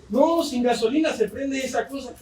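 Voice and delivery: high-pitched mock protest from a friend; mock objection. Acting out friend's comeback in anecdote.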